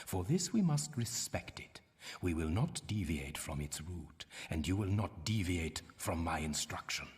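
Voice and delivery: ordinary talk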